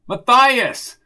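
loud, drawn-out call; exasperated shout. Acted. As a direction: shout to be heard through a door. Repeats name louder through door.

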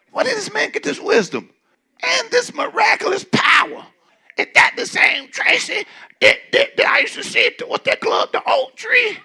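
very high squealing mock crowd; high-pitched scandalized gossip; gossipy mock voice. Shown as a demonstration. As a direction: squeal it like nosy church folk. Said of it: "do you think they said it like this?".

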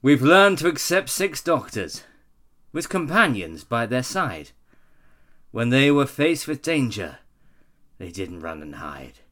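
loud, slow dramatic reading; dramatic recital. Acted. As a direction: declaim it slowly and loudly. Recites the third verse.

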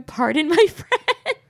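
giggling mock lady voice. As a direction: imitate her while cracking up. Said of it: "her going".

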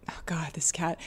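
whispered under the breath; whispered aside. Real, not acted. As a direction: mutter it as a quiet aside. Aside about the cat.

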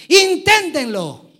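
Inténtenlo shouted high, slow and loud; exclaimed. Shown as a demonstration. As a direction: hit Inténtenlo with full exclamation force. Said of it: "Es que es con signo de admiración".

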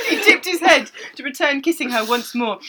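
normal talking voice

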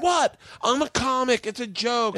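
high, hammed-up protest; mock defensive. Hardcore Italian comic explains himself to the crowd, mock defensive. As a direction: imitate an incredulous comic pleading his case.

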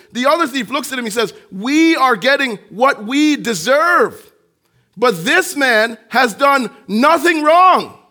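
loud, high preacher building to a peak; fervent sermon climax; preaching at full force. Acted. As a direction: drive it home to the congregation.

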